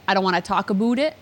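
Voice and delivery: "about" said as aboot; Winnipeg-style "about", rhyming with boot; Winnipeg long ooh. Shown as a demonstration. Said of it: "have a longer ooh sound".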